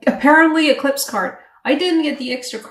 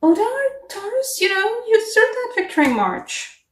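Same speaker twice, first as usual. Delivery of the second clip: mock cheery realization; 11 semitones higher than usual. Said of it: "July is gonna show that".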